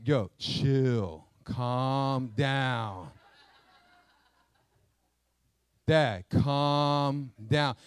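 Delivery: put-on a cool kid telling his dad to relax; deep, drawn-out chill voice with a laugh; laid-back teen son. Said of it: "Caden was like".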